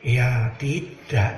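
drawn-out, low, unbothered "ya tidak"; slow low calm refusal. Retold or imitated. Kiai Ma'ruf turning down the petitioner again, slow and low. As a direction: say no slowly, unmoved.

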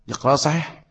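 ordinary delivery

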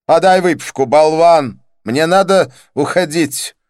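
loud, high-pitched order; high, blustering impatience; irate demand. A narrator's reading. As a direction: yell impatiently at a waiter. Didier snaps at the waiter.

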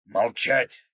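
loud, abrupt order to be silent; angry roar. A narrator's reading. Krot pushing Akulov away, angry roar. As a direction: roar it as a command. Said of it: "взревел Крот".

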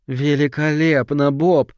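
strained, slow mock praise; forced admiration. A narrator's reading. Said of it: "попыталась придать голосу восхищение".

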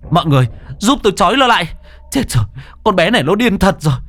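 high, loud, fast frantic pleas; panicked shouting. Acted. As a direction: scream for help as panic builds. Hùng panics, calls for help. (anh Hùng hoảng loạn hét gọi mọi người)

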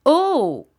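a sudden shocked cry; shocked exclamation. A narrator's reading. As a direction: gasp out Oh in alarm. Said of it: "fit Bobby".

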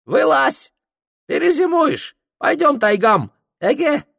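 put-on high, broken-Russian old Tungus voice; Tungus guide. Tungus greeting Prokhor in a high character voice.

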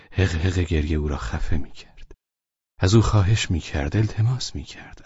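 plain reading voice

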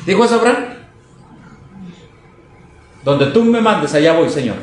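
usual speaking voice

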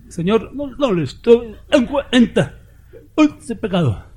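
high, slow, whimpering mock plea; whining plea. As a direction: beg like a broken man pleading on the ground. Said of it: "arrastrado y chillando".

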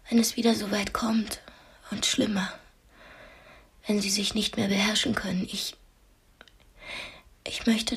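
hushed, breathy whisper, barely voiced; a tense, secret whisper at the tea table; whispered confession. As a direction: whisper it so only he can hear. Confiding in her captor over tea.

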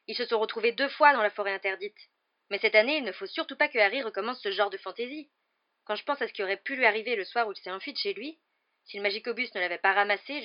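lightly voiced worried father; insistent arguing father. A narrator's reading. Father fearing for Harry's safety, mildly heated. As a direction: press the case with some force.

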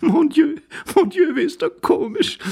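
very high, tickled lady's voice; laughing lady. A narrator's reading. Lady finding the poor man comical, highly amused. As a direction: exclaim in helpless amusement. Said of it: "lachte die Dame von ganzem Herzen".

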